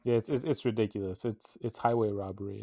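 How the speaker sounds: ordinary delivery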